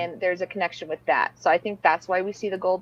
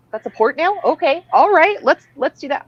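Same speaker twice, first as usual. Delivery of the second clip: higher, louder surprise; surprised; 8 semitones higher, 7 dB louder than usual. Retold or imitated. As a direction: react with startled delight. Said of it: "I'm like".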